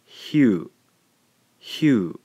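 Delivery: "hue" said as Japanese hyu, clear and stressed. Shown as a demonstration. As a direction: say "hue" carefully as a single syllable hyu. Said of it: "gives us the sound".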